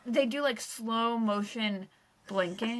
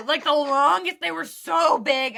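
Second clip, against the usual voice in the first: usual speaking voice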